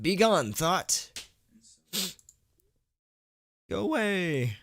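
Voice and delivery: high, hammed-up banishing cry; hammed-up priest banishing a thought; mock exorcist. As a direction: cast the bad thought out like a priest.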